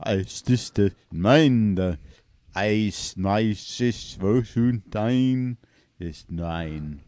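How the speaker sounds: slow mock German gibberish; hammed-up German with hardened finals; German accent. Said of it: "entertain us with his German accent impression".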